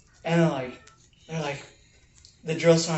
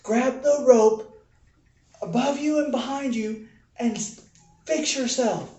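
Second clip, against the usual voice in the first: mock sergeant yell; 9 semitones higher, 4 dB louder than usual. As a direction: bark the rescue instructions up the wall. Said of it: "they're just yelling at him".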